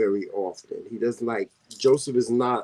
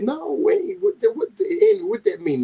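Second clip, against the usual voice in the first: high incredulous. Real, not acted. Arguing over bread words.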